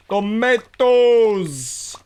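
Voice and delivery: loud, long drawn-out TOMATOES; playful cry. Pretend.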